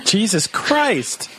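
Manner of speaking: appalled exclamation. Real, not acted. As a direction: stretch both words out, aghast. Appalled reaction to crude letter.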